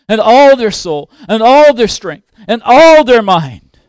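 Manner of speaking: impassioned pulpit exhortation; forceful preaching. Real, not acted. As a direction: drive each phrase home to the congregation. Preaches an emphatic appeal.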